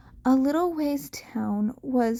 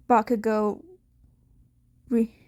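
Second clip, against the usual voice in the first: plain reading voice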